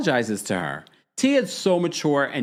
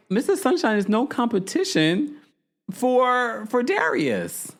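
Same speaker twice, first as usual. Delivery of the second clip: mock cast member; 10 semitones higher than usual. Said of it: "everyone was like".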